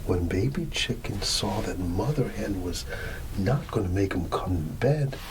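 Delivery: usual speaking voice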